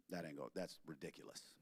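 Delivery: a low, soft brush-off; dismissive mutter. Retold or imitated. Wife dismissing his idea, low and soft. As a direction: wave it off under your breath.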